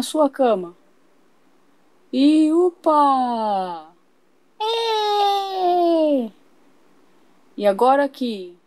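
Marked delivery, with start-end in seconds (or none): 4.6-6.3 put-on high delighted baby squeal. Baby being lifted into bed, high happy squeal. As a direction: squeal like a tiny child lifted up.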